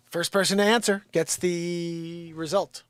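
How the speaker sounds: loud, slow announcement; calling out. Real, not acted. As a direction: call it out to the chat. Calling out to chat.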